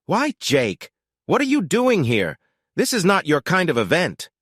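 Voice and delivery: high, scornful, looking down her nose; haughty high voice dripping with contempt; dripping disdain. A narrator's reading. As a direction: sneer at him as if he doesn't belong. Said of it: "said with dripping disdain".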